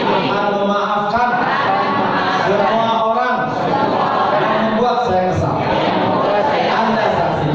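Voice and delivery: loud, chanted group vow; loud pledge. Shown as a demonstration. Preacher leads the audience in a vow to forgive, loud chant. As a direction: lead the crowd through an oath. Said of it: "siap praktek?".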